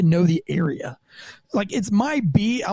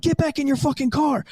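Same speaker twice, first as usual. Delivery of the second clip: higher, firm mock yell; barked command; 7 semitones higher than usual. Cop retelling ordering the driver back, mild mock yell. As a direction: order him back in the car. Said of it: "I'm like".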